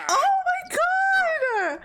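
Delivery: very high, drawn-out squeal; shocked shriek. Real, not acted. Streamer reacting to a fight, shocked shriek. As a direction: wail it high, loud and slow.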